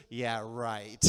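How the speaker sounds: low-pitched sarcastic dismissal; oozing contempt. Acted. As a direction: let disbelief ooze from the words. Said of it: "And it just oozed".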